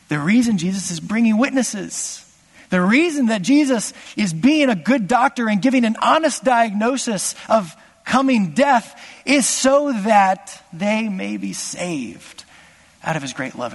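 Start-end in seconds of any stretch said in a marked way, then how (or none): none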